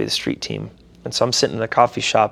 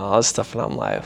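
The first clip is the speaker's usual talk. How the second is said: usual speaking voice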